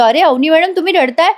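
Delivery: retold worry, pitch lifted in urgent concern; tense, worried. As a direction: act out an anxious man asking what happened. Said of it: "राजाला येतं tension".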